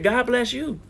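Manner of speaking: usual speaking voice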